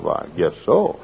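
low, hushed modest reply; quiet low aside; very soft. Retold or imitated. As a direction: drop right down, softly and modestly. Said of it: "I said that very softly".

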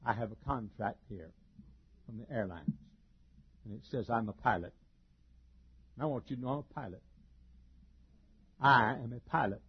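a lightly voiced dull pilot insisting; dumb pilot. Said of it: "dumb look on his face".

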